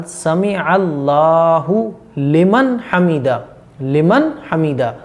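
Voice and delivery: slow "समी अल्लाहु लिमन हमीदा", every word apart; careful correct pronunciation. Shown as a demonstration. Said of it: "अच्छे तरीके से याद रखें". ("समी अल्लाहु लिमन हमीदा" साफ़, ठहरकर)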